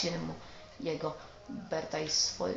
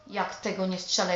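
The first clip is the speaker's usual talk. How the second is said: ordinary delivery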